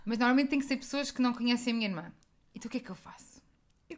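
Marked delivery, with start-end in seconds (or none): 2.5-4.0 hushed secret aside; whisper. Real, not acted. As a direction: whisper it like a confession. Conspiratorial aside.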